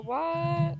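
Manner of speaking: normal talking voice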